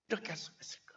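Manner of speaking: whispered, bitter disbelief; hushed repeat. Retold or imitated. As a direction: mutter it to yourself, stunned.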